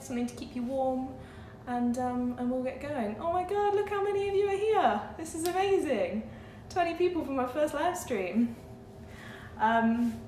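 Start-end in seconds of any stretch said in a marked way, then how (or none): 3.2-8.6 high-pitched, delighted surprise; thrilled, high-voiced exclamation; amazed delight. Real, not acted. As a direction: gush at the turnout, pitch up high. Delighted at how many viewers joined.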